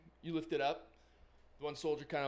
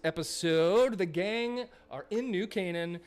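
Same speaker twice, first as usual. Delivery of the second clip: announcer voice; 2 semitones higher, 4 dB louder than usual. Acted. Host to audience announcing halftime break, upbeat announcer. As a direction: sell the break like a radio host.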